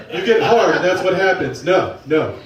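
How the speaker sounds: ordinary delivery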